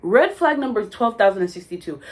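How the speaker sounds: ordinary delivery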